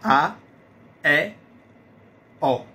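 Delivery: A, E, O held open and clear; open vowel demo. Shown as a demonstration. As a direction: show the open mouth on each vowel. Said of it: "ver como funciona realmente a boca".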